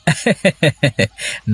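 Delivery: short embarrassed chuckle; sheepish laugh. Acted. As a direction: laugh it off before begging for food. Wife threatens to take plate. (গিন্নির হুমকিতে লাজুক হাসি)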